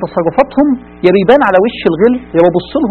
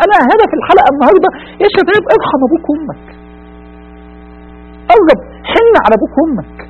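tearful plea; 7 semitones higher than usual. Acted. مناشدة عاطفية في الختام.

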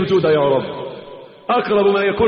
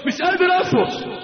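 مش قادر أصبر pitched much higher, pleading; desperate cry; 9 semitones higher than usual. Retold or imitated. Warrior in battle crying out to his comrades, with rising desperate longing. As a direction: cry out that you can't hold back, voice lifting high.

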